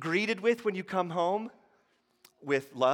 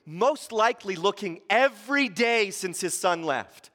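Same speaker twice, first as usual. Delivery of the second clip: ordinary talk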